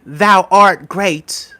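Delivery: slow theatrical Shakespearean delivery; hammed-up Shakespearean accent; Shakespearean English. Shown as a demonstration. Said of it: "when they speak like this".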